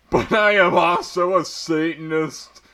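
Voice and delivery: high, loud hammed-up angry reveal; angry declaration. A narrator's reading. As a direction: declare it after a dramatic pause. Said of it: "Hagrid paused angrily".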